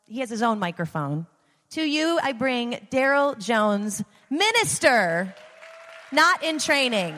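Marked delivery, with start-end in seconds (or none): 1.7-7.1 booming introduction. Real, not acted. Announcing the next minister.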